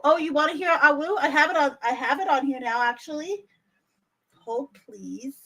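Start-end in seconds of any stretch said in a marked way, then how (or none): none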